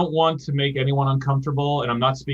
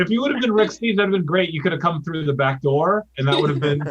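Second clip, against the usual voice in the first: higher and louder, gleeful; excited; 6 semitones higher, 4 dB louder than usual. Acted. Improviser excitedly pitching a better joke to castmate. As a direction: pitch it up with delight. Improv scene, laughing suggestion.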